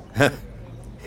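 short breathy laugh; a quick amused laugh at her joke. Acted. Amused by her dating quip.

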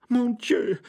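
high, stunned lady's exclamation; dumbfounded female voice; aghast lady. A narrator's reading. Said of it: "murmelte die Dame ganz entgeistert".

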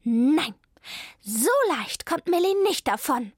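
loud, indignant cat; defiant refusal. A narrator's reading. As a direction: put your foot down, voice climbing. Zelda angrily refuses to give up. (empört und entschlossen)

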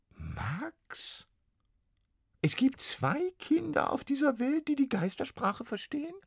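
very high, careful squeaky ghost; cautious little ghost. Acted. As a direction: ask it gingerly as the tiny ghost. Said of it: "sagte die Stimme in der Oboe vorsichtig".

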